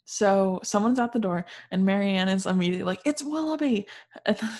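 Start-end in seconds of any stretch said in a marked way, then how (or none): 3.0-3.9 higher-pitched acted excitement; excited exclamation. Marianne hearing someone at the door, excited. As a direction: imitate an eager announcement.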